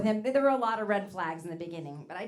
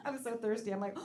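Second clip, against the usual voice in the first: ordinary talk, nothing marked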